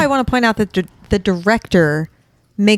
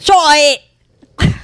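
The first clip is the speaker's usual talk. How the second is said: mock Australian accent; 6 semitones higher, 5 dB louder than usual. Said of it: "me doing Melissa doing an Australian accent".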